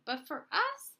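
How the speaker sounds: ordinary delivery, nothing marked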